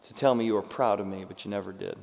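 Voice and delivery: usual speaking voice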